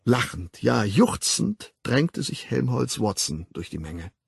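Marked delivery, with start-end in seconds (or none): none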